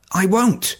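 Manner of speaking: high, strained promise; tearful promise. A narrator's reading. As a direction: promise through rising panic. Son's promise to dying father.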